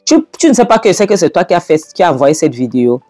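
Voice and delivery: high, indignant quarrelling; heated accusation. Acted. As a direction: throw the blame back at them. Acted quarrel, accusing Choma.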